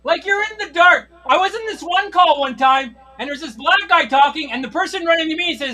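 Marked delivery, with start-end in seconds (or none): none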